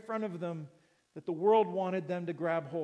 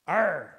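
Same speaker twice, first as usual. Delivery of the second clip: growled pirate arr; a pirate's growl; 4 semitones lower, 5 dB louder than usual. Shown as a demonstration. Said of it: "It's my best pirate voice".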